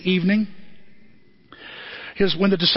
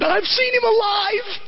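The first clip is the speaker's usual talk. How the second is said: joyful shout; slower than usual. Acted. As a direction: cry out that he's alive. Mary announces Jesus alive.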